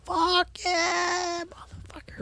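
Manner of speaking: very high, half-breathless triumphant cry; overwhelmed with celebration; losing it with joy. Retold or imitated. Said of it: "Losing my shit".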